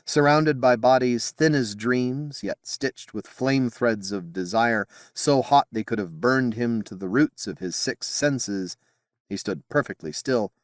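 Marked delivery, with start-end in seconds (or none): none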